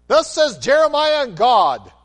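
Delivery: booming mock decree; mock grand proclamation. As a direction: ham up a self-important prophet. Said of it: "Does he say".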